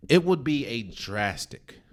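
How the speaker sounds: drastic said slow and leaned on; stress on drastic. Real, not acted. Ranking NBA contenders, stressing drastic slowly. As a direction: weigh down the word drastic. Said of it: "I emphasize the word drastic".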